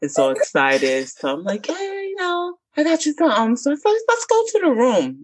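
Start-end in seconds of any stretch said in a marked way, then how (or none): 1.7-5.2 high, coaxing voice; fake sweet. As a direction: lure him with sweetness. Luring boyfriend to his room.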